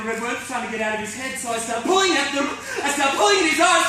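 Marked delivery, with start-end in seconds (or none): 1.5-2.5 raised, loud, urgent telling; rising panic. Acted. Tearing at the worms in his eyes, rising panic. First take, horror starts to build. 2.8-3.9 higher, louder, near shouting; frantic. Acted. Pulling at Tony's eyes in the mirror, frantic. Repeat climbs towards the scream.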